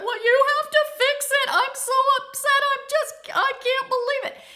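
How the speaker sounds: high, loud hammed-up blubbering; re-enacted distraught, squealing outburst; tearful wailing. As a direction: wail it like a mock hysterical meltdown. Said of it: "I'm blubbering, I'm crying on the phone".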